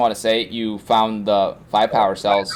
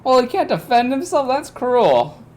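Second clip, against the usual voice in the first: mock indignant. Mock protest high voice.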